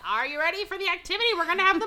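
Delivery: high, fast and upbeat; peppy activities director. Retold or imitated. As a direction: hype up the guests like a cruise host. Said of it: "And he's like".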